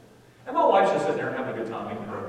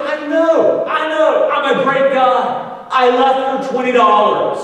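high, very loud mock rant; exasperated shouting; 11 semitones higher, 15 dB louder, slower than usual. Husband telling wife about the tip, exasperated shout. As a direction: shout it high, stretching the last line. Acted frustrated outburst in car.

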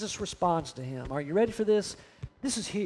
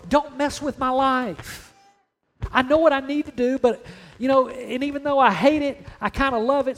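higher-pitched, insistent bargaining with God; mock desperate plea, pitched up; acted pleading rebuttal; 4 semitones higher than usual. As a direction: protest and plead like a reluctant believer. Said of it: "Here's what some of our rebuttals look like".

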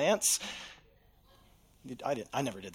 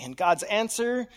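ordinary talk

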